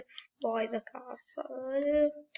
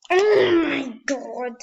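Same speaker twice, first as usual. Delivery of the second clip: loud exasperated cry; 7 dB louder than usual. Real, not acted. Frustrated exclamation.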